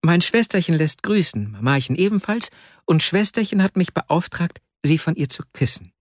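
lightly voiced little boy; young boy's voice. A narrator's reading. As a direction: pass on greetings as a dutiful child. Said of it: "etwa zehnjähriger Bengel mit schwarzen Locken".

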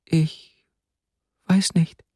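put-on high, small girl's voice; high-pitched bashful child; timid little girl. A narrator's reading. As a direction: answer timidly, as if barely daring. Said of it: "antwortete die Kleine kaum hörbar".

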